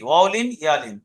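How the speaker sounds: ordinary delivery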